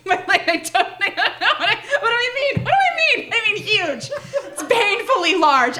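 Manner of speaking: high, giggling fluster; nervous laughter. Acted. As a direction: laugh through the embarrassment. Nervous laughter asking for dildo.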